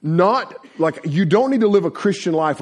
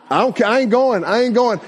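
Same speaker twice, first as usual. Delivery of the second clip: higher, louder stubborn shout; defiant yell; 8 semitones higher, 4 dB louder than usual. Retold or imitated. Stubborn teen yelling at the boat driver. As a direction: yell your refusal to let go.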